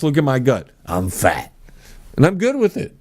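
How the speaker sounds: normal talking voice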